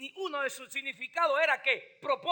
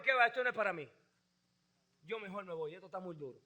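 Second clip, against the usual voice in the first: much softer, lower mumble of giving up; quitting disciples' words; 9 semitones lower, 11 dB quieter, much faster than usual. Retold or imitated. Preacher voices disciples who quit, soft lower mumble. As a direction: trail off like someone walking away.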